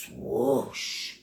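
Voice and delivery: breathy, drawn-out "swoosh"; swoosh noise. Shown as a demonstration. Painting teacher to students following the brushstroke, breathy swoosh noise. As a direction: make the brushstroke's airy whoosh. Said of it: "Make that noise".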